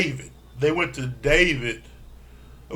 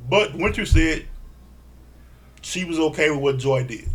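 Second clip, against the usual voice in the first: normal talking voice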